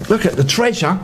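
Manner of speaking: ordinary delivery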